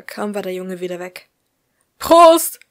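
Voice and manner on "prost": loud, high party cheer; cheering shout. A narrator's reading. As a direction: toast shouted across the table. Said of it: "riefen die anderen".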